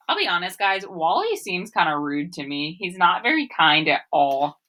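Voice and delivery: ordinary talk, nothing marked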